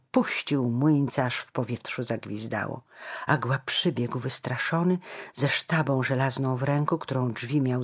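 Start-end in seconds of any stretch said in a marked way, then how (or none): none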